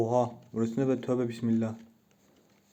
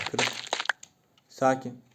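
normal talking voice

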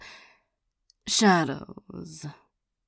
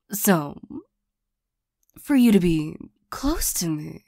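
ordinary delivery

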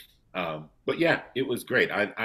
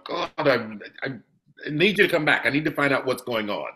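higher, louder pleading, half-mocking; imitating pleading fans; 6 semitones higher, 6 dB louder, faster than usual. Actor mimics fans begging for his return, eager. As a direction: play the eager fan begging him back. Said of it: "kinda gone".

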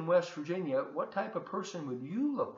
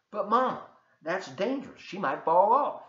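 higher, louder worried son pleading; worried protest; 6 semitones higher, 8 dB louder than usual. Acted. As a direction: plead with Mama, alarmed it's dangerous. Prince protests to Mama.

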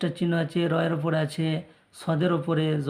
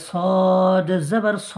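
slow, drawn-out chant of the syllable; recitation drill; 5 dB louder, much slower than usual. Shown as a demonstration. Teacher chants letter drills slowly for the class. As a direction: chant it slowly for students to copy. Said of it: "এটা আকার".

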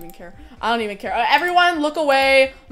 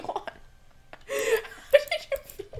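breathless laughing squeal; 6 dB quieter, much slower than usual. Real, not acted. Chat figured it out again.

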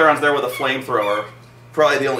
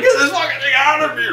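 high, loud mock shriek; hammed-up screaming; 13 semitones higher, 5 dB louder than usual. Woman running through the ship, hammed-up screaming. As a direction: yell it high in exaggerated panic.

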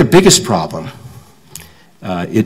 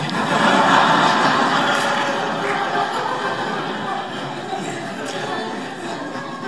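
high airy breathy laugh; soft unvoiced laugh, high up; real laugh; 9 dB quieter than usual. Real, not acted. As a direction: burst out laughing at yourself. Laughs at own story.

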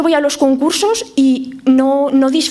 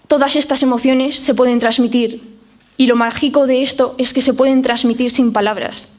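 ordinary delivery

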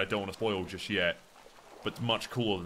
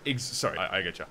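normal talking voice

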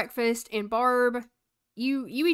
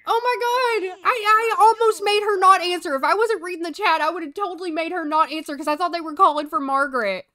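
higher and louder, breathless relief; relieved excitement; 6 semitones higher, 5 dB louder than usual. Real, not acted. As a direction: gush about almost missing it. Relief after nearly missing call.

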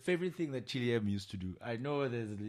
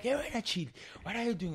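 much higher, scolding lady's voice; scolding cleaning lady; 11 semitones higher, faster than usual. Retold or imitated. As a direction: call him out like the cleaning lady.

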